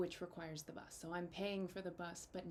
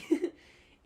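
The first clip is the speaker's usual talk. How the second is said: laugh; 9 semitones higher, 15 dB louder than usual. Real, not acted. Giddy over the big set.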